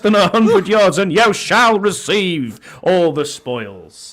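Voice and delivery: ringing proclamation like a royal herald; town crier voice. Acted. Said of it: "It is sound crier voice".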